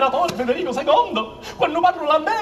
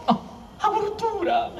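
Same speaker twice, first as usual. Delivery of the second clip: slow high exclamation; 7 semitones higher, 4 dB quieter, much slower than usual. Acted. King repeats his exclamation.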